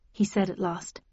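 ordinary delivery

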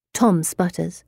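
usual speaking voice

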